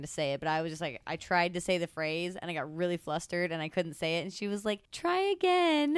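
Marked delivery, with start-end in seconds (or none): 4.9-6.0 high, drawn-out coaxing; sweet waiter's prompt. Retold or imitated. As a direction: sing it out slowly, well above your usual voice. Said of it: "really sweet".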